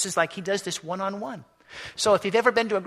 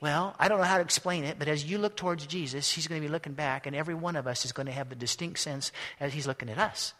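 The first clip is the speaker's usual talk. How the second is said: usual speaking voice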